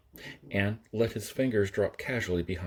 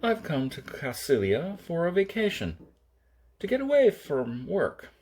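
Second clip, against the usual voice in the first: higher voiced Jason, annoyed; Jason, higher and irritated; 9 semitones higher than usual. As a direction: push back, offended and tight. Jason answers Kirk.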